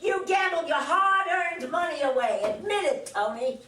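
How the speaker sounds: wife raises her voice at her husband. Acted. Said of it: "She raises her voice".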